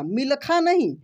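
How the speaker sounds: usual speaking voice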